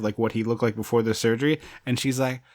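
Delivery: ordinary talk, nothing marked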